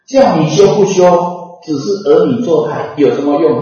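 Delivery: loud angry scolding of weeping disciples. Retold or imitated. Master rising from death scolds crying disciples, angry. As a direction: scold them as demons, loud. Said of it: "骂".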